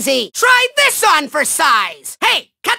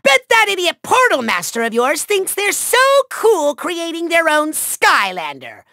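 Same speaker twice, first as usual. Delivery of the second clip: normal talking voice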